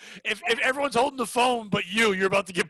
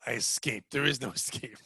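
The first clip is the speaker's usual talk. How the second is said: much lower, softer doom voice; ominous voice; 11 semitones lower, 7 dB quieter than usual. Acted. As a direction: growl it like a horror trailer. Ominous reply to escaping the bar.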